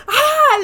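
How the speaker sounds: high, loud mock scream; re-enacted yelp of fright; sudden scream of being caught off guard; startled scream. Re-enacts his scream when scared.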